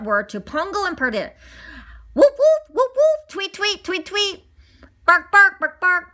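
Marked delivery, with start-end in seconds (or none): 2.1-6.0 mock barking. Twilight bark message.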